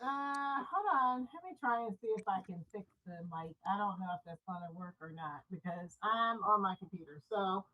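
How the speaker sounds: distant echo, far off the mic; talking from across a tiled room; echoing bathroom. Real, not acted. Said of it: "far away from the phone".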